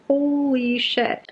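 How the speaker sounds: slow, high, drawn-out holy shit; a prince gaping in disbelief; stunned prince. Retold or imitated. The prince reacting to Violet, slow stunned disbelief. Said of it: "the prince is like".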